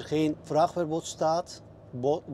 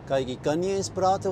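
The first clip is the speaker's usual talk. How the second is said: ordinary delivery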